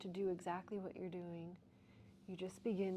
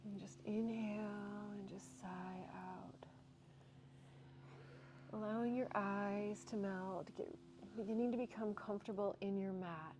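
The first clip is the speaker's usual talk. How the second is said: soft, unhurried breathwork guidance; guided breathwork; 3 dB quieter than usual. Acted. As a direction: guide the class gently into the stretch.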